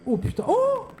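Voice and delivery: startled by a sudden jump scare; scared horror game streamer. Real, not acted. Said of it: "il m'a fait peur".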